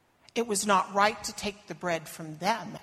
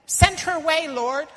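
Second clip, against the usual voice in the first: much higher, louder impatient call; dismissive shout; 7 semitones higher, 10 dB louder than usual. Acted. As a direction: wave her off loudly. Disciples dismissing her.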